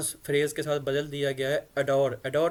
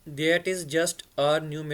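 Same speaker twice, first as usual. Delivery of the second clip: slow model reading; much slower than usual. Shown as a demonstration. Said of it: "ये अभी हमारी".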